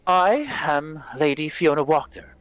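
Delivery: a hint of a prim noblewoman; formal lady host. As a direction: welcome guests with measured formality. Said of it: "I'd like to formally welcome you".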